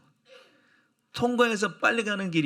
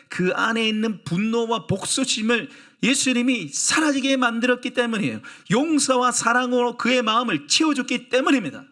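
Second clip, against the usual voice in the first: higher, louder proclamation; preaching at full force; 7 semitones higher, 4 dB louder than usual. Acted. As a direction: build the sermon's climax with conviction. Preaching climax.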